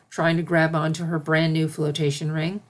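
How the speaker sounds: usual speaking voice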